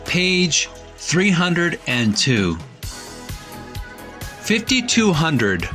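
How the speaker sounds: usual speaking voice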